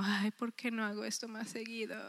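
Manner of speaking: breathless whisper of delight; whispered wonder. Retold or imitated. To the women's group, recalling joy after reading, whispered. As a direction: hush it like a happy secret.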